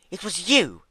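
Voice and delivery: loud, high shocked accusation; loud shocked disbelief. A narrator's reading. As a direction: realize it in disbelief. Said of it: "said Fluttershy in disbelief".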